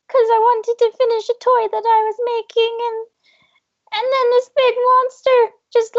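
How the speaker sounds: high, breathless little elf recounting the attack; a small elf still shaken by the monster; frightened elf telling. Acted.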